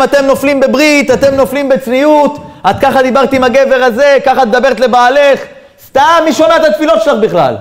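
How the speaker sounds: high-pitched mock accusation; acted scolding. Accuser listing people's failings to discourage them, scolding.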